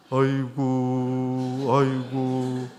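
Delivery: very slow, monotone funeral wail; imitated ritual keening. Shown as a demonstration. Preacher demonstrating mourners expressing sorrow, slow imitated keening. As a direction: hold each lament out long on one note. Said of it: "그 이제 고간다고 그러거든요".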